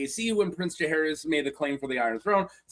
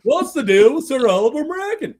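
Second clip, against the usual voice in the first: Seinfeld standup delivery; Jerry Seinfeld; 7 semitones higher, 7 dB louder than usual. Pretend. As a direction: observational comic sing-song. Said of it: "flipping into Jerry Seinfeld".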